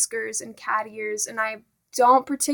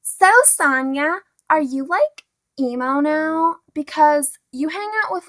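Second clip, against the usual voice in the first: mocking popular girl; 4 dB louder than usual. Mocking the popular girl who asked if she's emo. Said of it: "asks me completely seriously".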